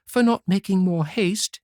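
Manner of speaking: put-on humble girl begging pardon; meek young girl. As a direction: apologise sweetly as the good daughter. Good girl answers her mother.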